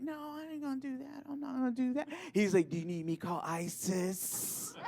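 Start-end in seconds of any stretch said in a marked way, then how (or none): 0.0-2.1 higher, hushed mock protest; quiet refusal. Comic refusing the boyfriend's laundry order, quiet protest. 2.7-4.7 lightly mocked camp voice, softer; campy boyfriend. Comic mimicking boyfriend's threat, lightly camp.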